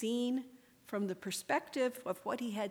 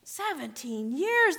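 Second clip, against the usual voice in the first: higher, louder, slow disbelief; a mother hearing an impossible wait; stunned repeat; 7 semitones higher, 6 dB louder, slower than usual. Acted. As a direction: echo the number back in shock. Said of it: "the woman said".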